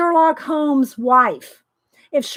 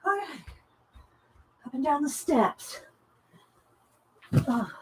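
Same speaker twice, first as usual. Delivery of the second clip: out of breath; 11 dB quieter than usual. Real, not acted. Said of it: "So let me catch my breath".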